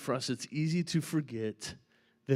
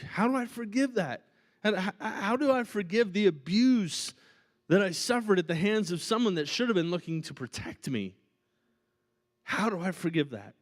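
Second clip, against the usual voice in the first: higher, louder anguished asking; higher pleading, a touch of anguish; pleading congregant; 6 semitones higher, 4 dB louder than usual. Retold or imitated. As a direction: voice a hurting person begging for an answer. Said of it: "You say".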